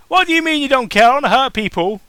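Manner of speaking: loud high yell; all caps shout. Shown as a demonstration. Reader showing the caps-lock shout. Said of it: "That's all caps".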